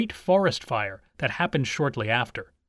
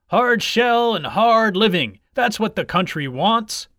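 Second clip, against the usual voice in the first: higher, loud campaign cry; rallying slogan; 5 semitones higher, 4 dB louder than usual. A narrator's reading. Supporters repeat their catchphrase to one another, rousing. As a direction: proclaim it like a crowd's slogan.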